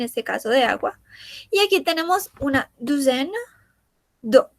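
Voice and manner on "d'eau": ordinary delivery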